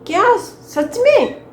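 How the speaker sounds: high, loud and slow; astonished. Acted. Daughter hears her mother's confession, slow astonishment. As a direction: stretch it out in disbelief.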